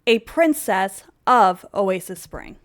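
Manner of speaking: loud, emphatic mock royal rant; acted angry tirade. Edward telling Isabel he is through, loud tirade. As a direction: lay down the law, hammering each order. King laying down law to queen.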